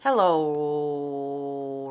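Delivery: ordinary delivery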